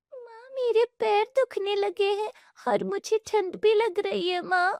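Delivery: put-on high, whining child voice; tired little girl. Daughter complaining to mother in the cold, high child whine. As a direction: complain of cold and sore feet.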